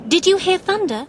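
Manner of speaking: high, loud called question; calling out. A narrator's reading. Sis asking her aunt about thunder, calling out. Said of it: "she called".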